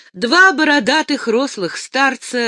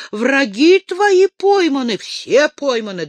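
louder, higher, eager news; rushing to tell the prince; excited; 5 semitones higher, 5 dB louder than usual. A narrator's reading. Першин радостно сообщает. (радостно и громко)

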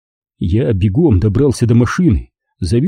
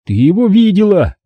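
higher shout on его; 8 semitones higher than usual. Acted. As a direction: yell it back at her. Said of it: "снова выкрикнул я". (сын выкрикивает матери)

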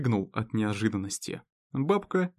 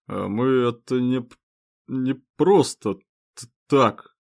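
tipsy stammering protest, louder; drunk slurring; 5 dB louder than usual. Acted. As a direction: slur and stumble over the words. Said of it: "запротестовал ещё не совсем пьяный".

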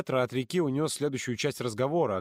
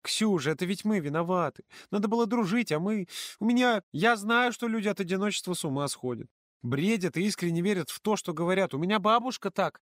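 put-on higher earnest girl's voice; earnest and worried, much higher; serious girl; 9 semitones higher than usual. A narrator's reading. (Полина серьёзно объясняет подруге)

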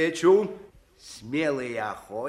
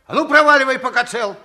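loud, threatening order; angry threat; 3 semitones higher, 6 dB louder, faster than usual. Acted. Hunter chases the boy away: loud threat. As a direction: drive the kid off.

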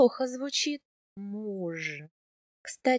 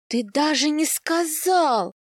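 higher, louder, very slow pout; pouting offence; 5 semitones higher, 5 dB louder, much slower than usual. A narrator's reading. Wife pouting at her husband, higher and very slow. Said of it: "обиженно надуваю я губы".

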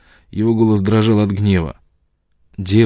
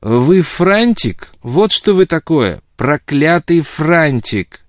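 high, loud, drawn-out jeer; scornful shouted taunt; parting insult; 7 semitones higher, 5 dB louder, much slower than usual. A narrator's reading. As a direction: fling the insult back from the doorway. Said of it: "кинула ему дева".

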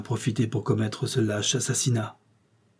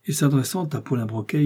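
usual speaking voice

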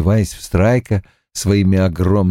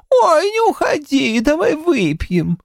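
high squeaky mimicry of a tipsy woman; mock her in a silly high squeak. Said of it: "заговорил писклявым голосом, передразнивая Гейл".

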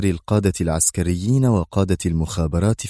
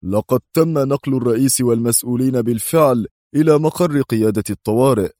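firm, raised-pitch statement; stern interruption; 5 semitones higher than usual. A narrator's reading. Intelligence director interrupts the security chief, sternly. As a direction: cut in sternly. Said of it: "قاطعه مدير المخابرات في صرامة".

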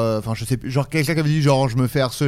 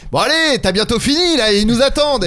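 impatient; 6 semitones higher than usual. Retold or imitated. Impatient husband in the play.

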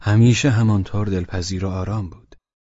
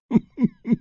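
short high chuckle; 14 semitones higher than usual. A narrator's reading. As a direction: give a brief, high acted laugh. Laugh voiced after old man's farewell. (خندهٔ کوتاه و زیر)

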